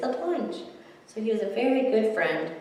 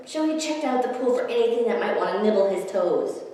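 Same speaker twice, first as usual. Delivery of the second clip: usual speaking voice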